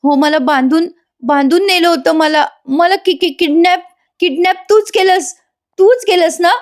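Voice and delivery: high, stammering recollection act; imitated struggle to recall; trying to remember. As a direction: piece it together haltingly, pitch climbing to accusation. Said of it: "आठवायचा प्रयत्न करते".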